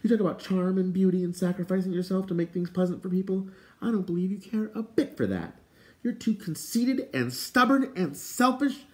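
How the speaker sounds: high-pitched, indignant outburst; sudden exclamation. A narrator's reading. Eilonwy scolding the fair folk's king, high indignant exclamation. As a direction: exclaim it high, slowing on the list of faults. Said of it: "Eilonwy suddenly exclaimed".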